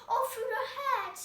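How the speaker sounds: high, loud royal scream; furious shout. A narrator's reading. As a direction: scream the sentence at them. Queen orders beheading.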